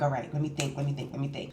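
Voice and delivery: low, soft self-talk; acted-out hushed pondering; thinking to oneself. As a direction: murmur it as if working out a plan. Thinking to herself, retold.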